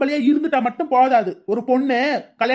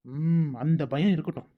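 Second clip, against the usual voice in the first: low menacing warning; 8 semitones lower, 7 dB quieter, slower than usual. Acted. Warns the gossipers.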